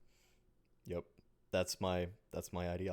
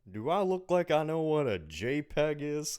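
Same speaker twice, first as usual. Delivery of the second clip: higher, louder Texan dad; baffled Hank Hill, a bit louder; 8 semitones higher, 7 dB louder than usual. Retold or imitated. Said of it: "that was so good".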